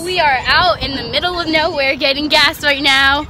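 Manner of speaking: loud, high, excited road trip voice; loud excited chat. Real, not acted. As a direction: shout over the car noise, excited.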